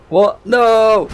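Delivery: high, loud startled yelp; sudden loud protest; shocked cry. Real, not acted. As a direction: cry out in dismay. Sudden in-game death.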